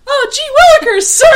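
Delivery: put-on high, loud gee-whiz boy; hammy kid voice. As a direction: squeak it out like an eager kid.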